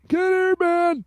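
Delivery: a hint of a cranky old viewer; old man voice. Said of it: "You're doing well doing an old man voice".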